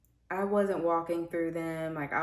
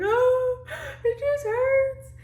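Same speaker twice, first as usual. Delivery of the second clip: much higher, louder, slow crying wail; reenacted crying; 9 dB louder, much slower than usual. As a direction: relive the sob, voice cracking high. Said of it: "I was crying so hard".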